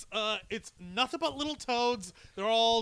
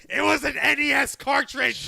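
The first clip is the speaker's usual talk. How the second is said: loud, higher outburst; excited exclamation; 5 semitones higher, 9 dB louder than usual. Real, not acted. Host revealing a nostalgic detail to co-hosts, excited shout. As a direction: blurt it out loud and high.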